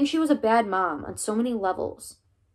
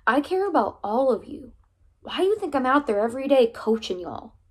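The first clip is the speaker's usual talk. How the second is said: earnest protest; 5 semitones higher, 5 dB louder than usual. Acted. Coach answering the angry boy.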